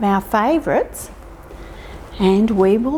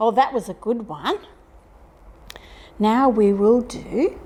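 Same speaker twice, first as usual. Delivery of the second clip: ordinary talk, nothing marked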